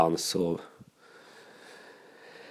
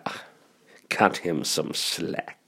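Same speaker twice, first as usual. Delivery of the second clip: 1 murmured thought; 5 semitones lower, 8 dB quieter, slower than usual. Retold or imitated. Inner thought, letting it go.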